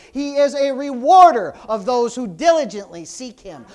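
loud, emphatic sermon declaration; booming preacher; full-force preaching. Real, not acted. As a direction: hammer the promise home to the congregation.

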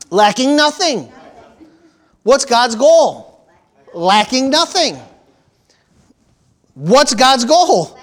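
preacher building fervor; emphatic preaching. Acted. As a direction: hammer the refrain home to the congregation. Preaching repeated call and answer.

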